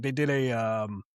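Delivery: ordinary delivery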